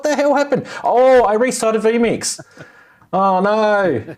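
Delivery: high, alarmed oh no; real alarm at a glitch; dismayed. Real, not acted. Stream chat vanished after restart.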